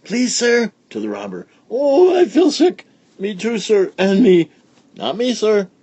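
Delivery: put-on high, loud squealing kids; children shouting to the robber on the bus; yelling children. A narrator's reading. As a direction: shriek like kids on a ride. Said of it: "the children began to yell".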